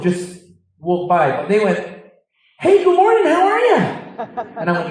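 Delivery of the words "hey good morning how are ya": high, loud, bouncy morning greeting; chirpy jogger. Retold or imitated. Joggers greeting a Londoner at dawn, high and beaming. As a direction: play the overly cheerful jogger calling hello.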